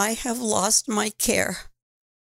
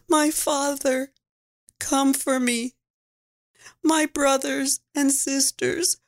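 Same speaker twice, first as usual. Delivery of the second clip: plain reading voice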